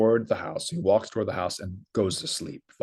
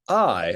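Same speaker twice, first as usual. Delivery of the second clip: "I" stressed with raised pitch; emphatic I; 4 dB louder than usual. Shown as a demonstration. Teacher translating the Greek direct quote, emphatic. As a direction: lean firmly on "I". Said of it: "this is emphatic here".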